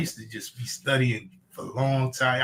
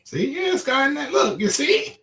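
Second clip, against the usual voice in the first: excited; 9 semitones higher than usual. Real, not acted. Excited pointing out the AI.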